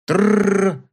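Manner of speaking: rolled "trrr" of splintering wood; imitated cracking noise. A narrator's reading. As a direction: trill it like a crate being pried open. Imitated noise of prying crates.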